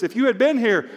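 high, loud challenge to the Lord; confrontational. Acted. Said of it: "Martha kinda confronts Jesus".

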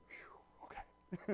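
soft whispery exhale of relief; mimed sigh of relief; relieved phew. Acted. Servant's relief after seeing the chariots.

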